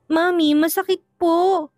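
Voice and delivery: slow, high whimper of pain; a child voice aching after a pinch; pained plea. A narrator's reading. Child pinched by her mother, slow high whimper. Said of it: "ang sakit ng kurot niya".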